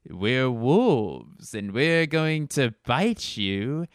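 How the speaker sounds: hammed-up bad German accent, slow and menacing; a goofy villain doing a phony German accent over the radio; silly fake German accent. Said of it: "like a silly man doing an impression of a German voice".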